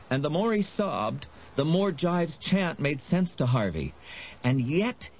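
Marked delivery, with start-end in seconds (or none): none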